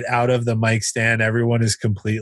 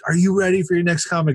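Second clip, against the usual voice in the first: faster, high-pitched emcee call; host rousing crowd; 7 semitones higher, faster than usual. Retold or imitated. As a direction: push the crowd harder for a cheer. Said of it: "he's like".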